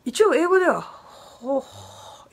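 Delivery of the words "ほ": ほ as a voiceless puff of H; breathed H. Shown as a demonstration. Teacher showing English sounds the H, ほ as pure breath. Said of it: "Hは発音する".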